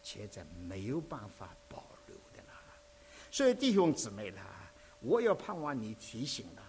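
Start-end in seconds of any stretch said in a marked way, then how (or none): 0.0-2.5 whispered aside. Acted. Preacher drops to a whisper.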